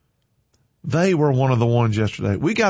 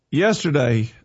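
ordinary delivery

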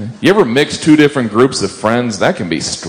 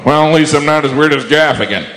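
lightly voiced relieved onlooker, pitched higher; higher, mildly mocking imitation of someone's thought; imagined audience member; 6 semitones higher than usual. Audience member sizing up the comedian, mildly mocking higher voice. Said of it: "looking at me going".

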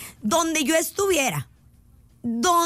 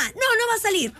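higher-pitched imitation of a mother's refusal; mamá negándose; 7 semitones higher than usual. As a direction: play a mom saying no. Voicing the friend's mother refusing. (negativa de mamá, en tono agudo)

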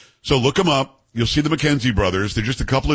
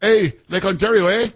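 a cheery, overdone Canadian "eh" to end it; Canadian accent impression; 8 semitones higher than usual. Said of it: "a spot-on impersonation of this guy Ford".

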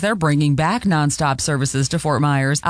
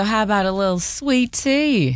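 a lightly put-on, higher Southern US accent; bad southern accent; 5 semitones higher than usual. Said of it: "try to have a southern accent again, 'cause that was bad".

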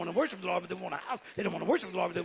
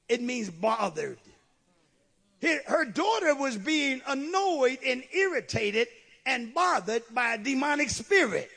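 ordinary talk, nothing marked

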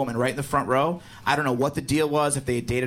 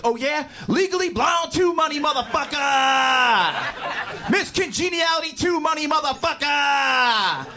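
imitating a gloating headliner, much higher, slow shout; triumphant shout; 7 semitones higher, slower than usual. Imagined headliner bragging to the crowd, gloating shout. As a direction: crow each sequel title. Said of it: "he had to run on stage and be like".

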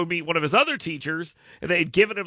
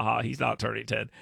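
ordinary talk

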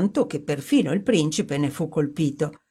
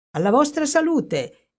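high, bright-spirited toast; exclaimed toast; 10 semitones higher, 4 dB louder than usual. A narrator's reading. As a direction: raise the glass with a high cry. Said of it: "esclamò". (brindisi esclamato, voce alta)

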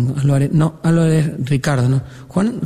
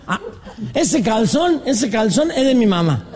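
lightly voiced drunk, raised pitch; 4 semitones higher, slower than usual. Drunk points out his mother's underwear to his friend, lightly slurred drunk act. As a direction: play a tipsy man pointing something out. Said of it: "Dos borrachos venían por la calle".

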